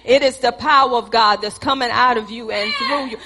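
ordinary talk

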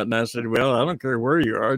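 normal talking voice

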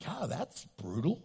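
soft mock wince; hurt reaction. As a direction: murmur it like a stung listener. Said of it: "in a way that was like".

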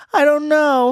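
put-on a scared girl who knows nothing; high small child voice, lost; high little-girl voice. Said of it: "she's like".

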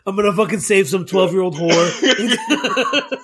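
laughing through the words; giggling while quoting; laughing mock quote. Voicing the writer, laughing.

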